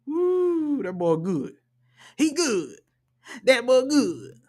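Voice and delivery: high, drawn-out whoop of praise; high, put-on hype voice; hyped admiration. Real, not acted. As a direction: hoot it up high, letting the pitch fall on each "good". Hyped reaction to a dangerous player.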